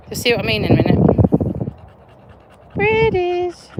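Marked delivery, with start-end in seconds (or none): 2.7-3.7 high, drawn-out coo; sing-song little marvel, pitched up; delighted cooing. Real, not acted. Cooing at pretty lights coming.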